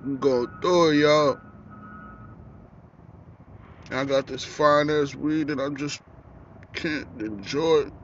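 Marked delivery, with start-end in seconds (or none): none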